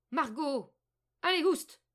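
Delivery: loud, high, impatient witch's call; old woman calling out. A narrator's reading. Impatient old woman summons Margot, loud high call. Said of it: "appela-t-elle".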